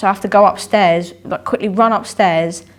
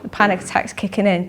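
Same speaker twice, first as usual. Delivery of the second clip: ordinary delivery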